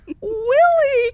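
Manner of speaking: joyful cry. Acted. Mouse finds his friend Willie turned real, crying out in joy. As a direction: shout his friend's name in pure delight. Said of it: "cried Alexander".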